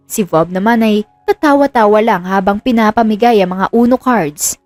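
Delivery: plain reading voice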